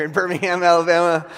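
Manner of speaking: loud, rising, excited shout over the crowd; half-laughing, playful shout back to the congregation; excited outburst. Real, not acted. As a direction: call it out with a laugh, swept up in the cheer. Crowd cheers, he answers excitedly.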